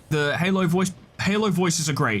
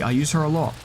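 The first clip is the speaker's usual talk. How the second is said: normal talking voice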